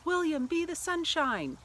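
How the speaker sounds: high, loud yell across the field; yelled at son. Retold or imitated. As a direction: shout it to your son from the stands. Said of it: "the time I had yelled".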